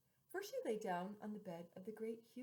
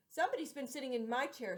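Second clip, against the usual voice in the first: louder mama bear voice; mama bear, firm and raised; middle-sized bear voice; 4 semitones higher, 9 dB louder than usual. Acted. Mama bear noticing her spoon, raised voice. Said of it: "in a middle voice".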